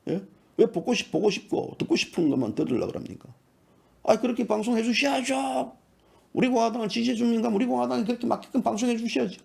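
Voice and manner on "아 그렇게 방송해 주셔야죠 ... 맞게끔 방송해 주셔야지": higher-pitched put-on voice of complaint; mock viewer demand, pitched up; mimicked demanding viewers. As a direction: imitate pushy viewers insisting on their line. Mimicking viewers who demand favourable broadcasts.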